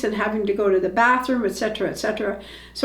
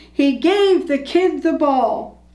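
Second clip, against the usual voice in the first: slow, drawn-out crowd cheer, only mildly raised; crowd yelling; 5 semitones higher than usual. A narrator's reading. Crowd on its feet clapping, slow mild yell. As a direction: spread the words out like a cheering crowd. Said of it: "clapping and yelling".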